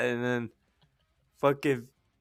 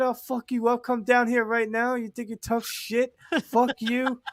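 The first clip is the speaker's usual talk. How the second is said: retold yelling; 10 semitones higher than usual. [name] quoting the angry guest's accusation, mock yelling. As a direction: imitate an angry guest in a raised pitch. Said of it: "yelling at me".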